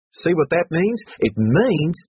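high, insistent character voice; brash student bursting with a sudden memory; Hatchet, urgent. Acted. Hatchet breaks in.

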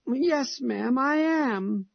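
put-on a little boy near tears insisting to his mother; sobbing boy. A narrator's reading. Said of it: "he sobbed".

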